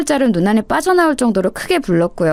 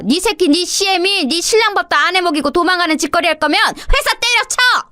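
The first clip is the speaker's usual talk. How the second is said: indignant tirade, climbing higher in pitch; raging mother-in-law; 4 semitones higher than usual. Acted. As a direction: lay into the daughter-in-law, building to a high-pitched outburst. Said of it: "막 길길이 날뛰시더라고요". (길길이 날뛰는 시어머니의 호통)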